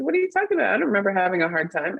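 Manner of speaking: high-pitched mock disbelief; baffled retort. Retelling own baffled reply to former director.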